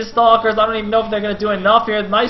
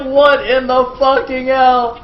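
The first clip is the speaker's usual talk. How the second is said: loud, high imitation of an outraged loser; mock incredulous rage, drawn out; pissed-off player; 6 semitones higher, 7 dB louder, slower than usual. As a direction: act out the furious player. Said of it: "Effort is so pissed!".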